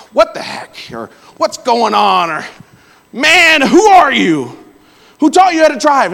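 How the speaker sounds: shouting at another car in a hammed-up rage; mock road rage. Yelling at driver.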